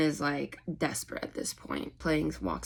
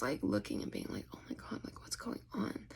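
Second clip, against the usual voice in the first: hushed whisper; 10 dB quieter than usual. Retold or imitated. Onlookers murmur.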